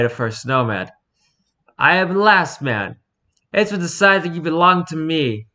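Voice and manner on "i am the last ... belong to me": slightly raised, louder chieftain voice; commanding tyrant. A narrator's reading. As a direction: declare it as the boy's new master. Tyrant announces his claim.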